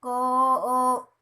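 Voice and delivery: क then अ pulled apart, the अ stretched; sound split demo. Shown as a demonstration. Teacher showing the class the अ inside क, split apart. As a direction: say क and then let अ ring out after it. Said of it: "इसके last में क्या आ रहा है".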